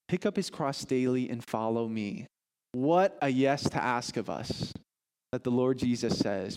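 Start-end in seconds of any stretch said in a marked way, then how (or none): none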